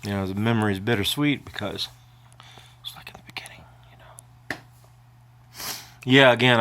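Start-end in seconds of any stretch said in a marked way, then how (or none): 2.8-4.6 choked-up whisper, barely any voice; crying. Real, not acted. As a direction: lose the voice to tears. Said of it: "I do cry".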